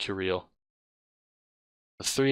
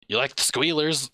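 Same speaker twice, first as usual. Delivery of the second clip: excited cabbie; 6 semitones higher, 3 dB louder than usual. Acted.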